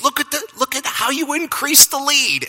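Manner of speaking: high-pitched, thrilled coach; imitated excited praise. Coach hugging his runner after the handoff, imitated excitement. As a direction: gush in mock amazement, pitch way up.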